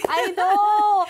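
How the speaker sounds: high, loud squeal of agreement; excited. Real, not acted. Host agrees with her guest that it finally happened, excited. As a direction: squeal it with delight.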